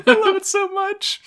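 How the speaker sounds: squealing high with glee; gushing delight. Real, not acted. Host gushing to cohost about the show, squealing high. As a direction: gush like a delighted fan.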